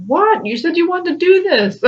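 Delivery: high, laughing mock complaint to her own soul; laughing mock exasperation. Scolding her own soul mid-reading, high and laughing. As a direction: squeak it out, half laughing, teasing your soul. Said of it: "I'm like".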